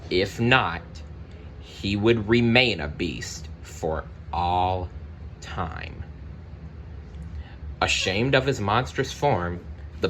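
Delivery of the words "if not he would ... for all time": ordinary delivery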